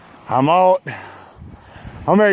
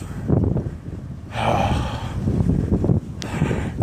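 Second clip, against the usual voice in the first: a long, breathy sigh of exhaustion; long weary sigh; 7 semitones lower, 5 dB quieter than usual. Real, not acted. Tired cyclist facing another hill, long weary sigh. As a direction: let all the air out, spent.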